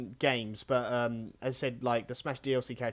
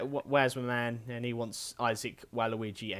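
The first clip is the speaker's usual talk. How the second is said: ordinary talk, nothing marked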